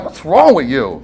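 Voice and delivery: lightly voiced wife, pitched low; a low, mock-puzzled question; imitated baffled wife. Wife looking at him in an argument, imitated low and baffled. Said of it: "she's looking at me like".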